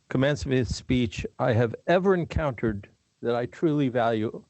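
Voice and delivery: ordinary delivery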